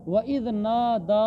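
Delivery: नादा recited slowly with a long-held "daa"; elongated vowel. Shown as a demonstration. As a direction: stretch the final aa of नादा. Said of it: "तो इसको खींचना है".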